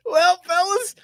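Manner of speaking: high, loud cartoon sign-off; Mickey. Hosts sign off the show in character, high cartoon voice. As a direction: close the show in a giddy falsetto, near laughter. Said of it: "an impression of Mickey".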